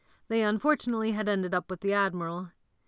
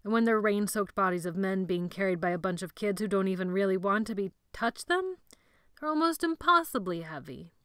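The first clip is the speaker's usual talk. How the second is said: usual speaking voice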